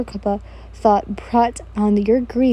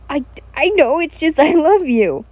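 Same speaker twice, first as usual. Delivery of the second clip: raised, stammered declaration of love; stammer, then call it out high; still yelling; 4 semitones higher, 4 dB louder than usual. Acted. Kojo confessing love to you, still yelling. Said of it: "you're still yelling".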